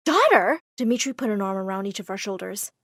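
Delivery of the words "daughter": high, astonished squeal; shrieked in shock. A narrator's reading. Two girls learning of a shared father, high mild shriek. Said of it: "Dakota and I shrieked together".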